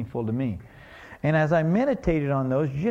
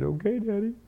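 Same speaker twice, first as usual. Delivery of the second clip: little daughter; 6 semitones higher than usual. Retold or imitated. Sick little daughter answering dad.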